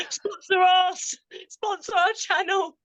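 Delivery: slow, high begging whine; pleading. Pretend. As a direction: beg like a child. Begging a sponsor.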